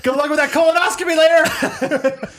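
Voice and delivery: high, laughing public shout-out; mock calling out. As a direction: holler it across the plane, amused. Mock calling out embarrassing wishes.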